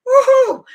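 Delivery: high, loud whoop; a sarcastic yelled woohoo; mock cheer. Whooping at the brother's mess.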